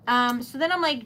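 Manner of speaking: ordinary talk, nothing marked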